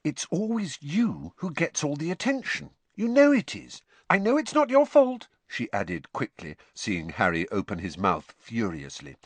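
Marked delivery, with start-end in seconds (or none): 0.0-5.3 much higher, steady explaining tone; patient Hermione. A narrator's reading. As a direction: explain it gently but firmly. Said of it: "said Hermione patiently".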